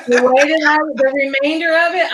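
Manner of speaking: laughing. Real, not acted. Laughing after co-host's drink joke.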